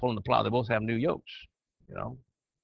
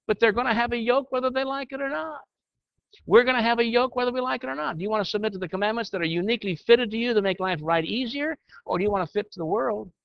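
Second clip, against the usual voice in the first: rising into a preacher's higher, pushing delivery; preaching; 7 semitones higher than usual. Real, not acted. Teacher warming to his point before the men's class, half preaching. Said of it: "You're gonna get me to preaching here".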